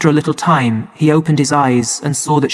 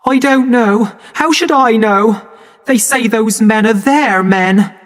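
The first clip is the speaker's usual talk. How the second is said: high, near tears; tearful mother; 8 semitones higher, 3 dB louder than usual. A narrator's reading. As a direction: voice close to breaking before she weeps. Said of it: "And she burst into tears".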